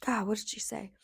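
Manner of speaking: soft whisper to herself; whispered aside. Real, not acted. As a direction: whisper while trying to remember. Aside trying to recall.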